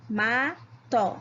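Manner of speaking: "Mato" split into ma, to, final o fully sounded; slow syllable by syllable. Shown as a demonstration. Teacher checking syllable counts with pupils, "Mato" slowly split. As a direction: say "Mato" as two separate syllables. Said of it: "Vamos falar todas devagarinho".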